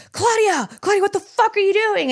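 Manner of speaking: high, alarmed demand from the partner. Retold or imitated. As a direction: imitate the ex startled awake, shouting her name. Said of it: "my ex next to me going".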